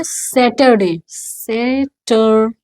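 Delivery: "सैटरडे" as SAT-er-day, then syllable by syllable; slow model pronunciation. Shown as a demonstration. Teacher drilling learners, "सैटरडे" slowly broken up. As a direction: draw out "सैटरडे" with pauses between syllables. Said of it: "ये क्या है?".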